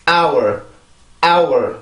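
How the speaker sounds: "hour" as a falling "ow" gliding into R; pronunciation demo. Shown as a demonstration. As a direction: model "hour" as isolated, careful "ow-er". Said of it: "요렇게 발음을 합니다". ("hour"를 '아우얼'로 또박또박 발음)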